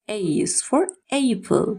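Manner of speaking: slow, spaced teaching read; A is for apple said slowly, word by word; slow drill. Shown as a demonstration. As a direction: spell it out slowly for a child. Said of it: "slow teaching drill".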